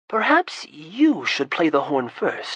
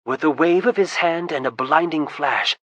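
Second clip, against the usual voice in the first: ordinary delivery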